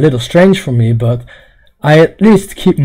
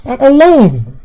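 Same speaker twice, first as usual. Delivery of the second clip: much higher mock lament; high mock wail; 8 semitones higher than usual. Streamer answers no-soulmate remark, mock wail. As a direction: cry it out high, hammed-up. Acts out being alone, high then low.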